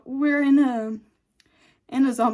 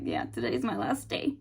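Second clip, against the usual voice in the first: low, soft and resigned, much lower and softer; resigned quiet; 12 semitones lower, 8 dB quieter than usual. Retold or imitated. Dying partner telling Bill it's his last day, low and quiet. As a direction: let it sink, quietly accepting the end. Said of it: "Frank's like".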